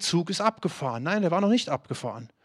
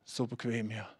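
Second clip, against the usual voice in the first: a soft, put-on sleepy voice; mock lazy reply; 7 semitones lower, 13 dB quieter than usual. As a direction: play the comfortable one who won't get up. Lazy listener answering.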